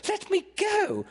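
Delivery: very high, indignant command; ordering. A narrator's reading. Wendy gripped by Peter, high indignant order. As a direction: order him off in a high girl's voice. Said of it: "she ordered him".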